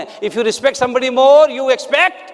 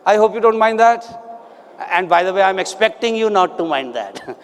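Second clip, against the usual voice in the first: ordinary delivery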